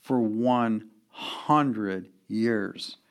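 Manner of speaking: very slow, each word set apart; slow for emphasis. Shown as a demonstration. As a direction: draw out ONE HUNDRED YEARS with weight. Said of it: "let me say it again slowly".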